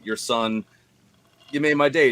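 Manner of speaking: ordinary talk, nothing marked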